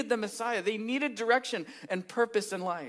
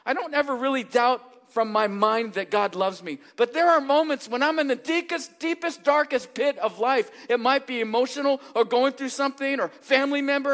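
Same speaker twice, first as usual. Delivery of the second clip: louder, higher fervent preaching; preaching at full force; 6 semitones higher, 6 dB louder than usual. Acted. Pastor urging his church that God loves them, fervent preaching. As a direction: drive it home to the congregation.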